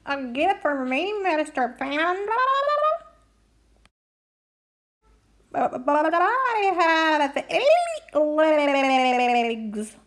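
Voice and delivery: giggly creature voice, drawn out and slow; slow, laughing critter voice; laughing octopus voice. Acted. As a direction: act puzzled and amused about the legs.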